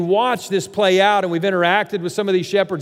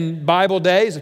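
usual speaking voice

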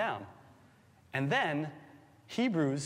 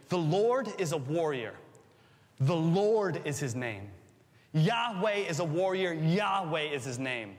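scripture reading. Acted. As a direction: read it out like a proclamation. Reads scripture aloud with force.

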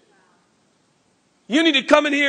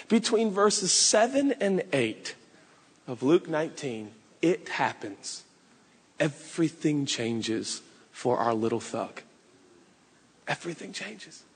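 hushed and dramatic; 9 semitones lower, 8 dB quieter than usual. Acted. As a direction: lower and softer, building suspense. Dramatic turning point in sermon.

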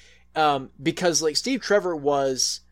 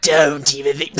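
creepy little voice; 7 dB louder than usual. Retold or imitated. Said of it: "creepy little voice".

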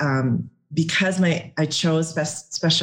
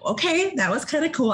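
high, delighted surprise; pleased surprise; 12 semitones higher than usual. Retold or imitated. After first runner up, high and delighted. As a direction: let the delight jump up high. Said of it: "I'm like".

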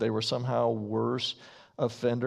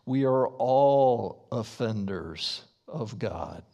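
ordinary delivery

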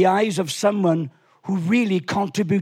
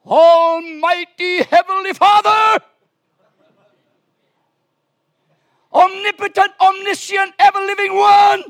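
mock formal prayer; 12 semitones higher, 8 dB louder than usual. Preacher mocking religious prayer, loud pompous voice. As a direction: ham up a grand churchy prayer. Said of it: "wrap our cry up into some religious format".

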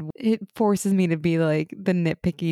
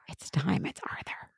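urgent hushed whisper; whispered warning; 9 dB quieter than usual. Retold or imitated. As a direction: breathe it out so no one hears. Character says it's time, whispering.

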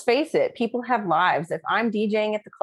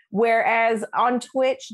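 ordinary talk

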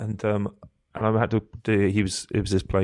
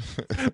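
high laugh; 3 dB quieter than usual. Real, not acted. Laughing at own story.

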